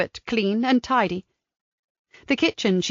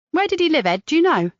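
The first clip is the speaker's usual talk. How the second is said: higher, quick and admiring; Kat's voice; 7 semitones higher, faster than usual. A narrator's reading. Kat compliments Mister Shipley's garden, higher and quicker. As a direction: gush over his garden.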